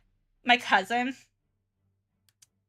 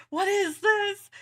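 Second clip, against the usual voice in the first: higher, slow, amazed question; a slightly higher, slower take of happy disbelief; recalling finding sweet tea in Italy, delighted and surprised; delighted surprise; 9 semitones higher, slower than usual. Retold or imitated. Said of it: "I was like".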